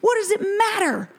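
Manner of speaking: hammed-up angry yell; angry man yelling. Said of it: "he just keeps yelling".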